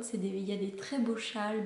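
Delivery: ordinary delivery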